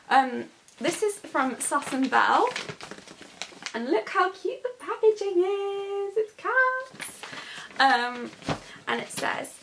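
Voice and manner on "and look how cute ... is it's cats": high, sweet cooing tone; cooing over cuteness. Real, not acted. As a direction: gush over the cute packaging. Showing cute packaging.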